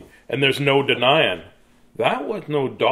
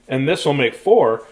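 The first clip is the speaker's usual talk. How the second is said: plain reading voice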